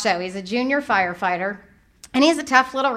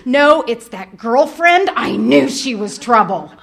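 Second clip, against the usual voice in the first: slow, loud, knowing accusation; suspicious inner voice; 6 dB louder, slower than usual. Retold or imitated. Mother deciding the girlfriend is to blame, slow and loud. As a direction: lean in hard on the suspicion. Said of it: "this is what happens in my head".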